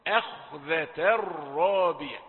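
slow "أخذة" with thin first a and dark kh; light hamza, heavy kha. Shown as a demonstration. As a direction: keep "أخذة" light at the start, thicken the kh. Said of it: "الهمزة رقيقة والخاء مفخمة". (همزة "أخذة" رقيقة وخاء مفخمة)

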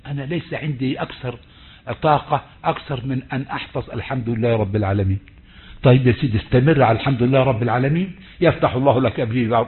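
ordinary talk

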